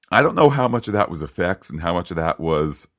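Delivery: ordinary talk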